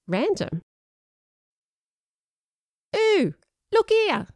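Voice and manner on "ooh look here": very high, slow little squeak of a voice; tiny worm voice. Acted. Sean puppeting biscuit worms, tiny high worm voice. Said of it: "Sean said in a tiny worm voice".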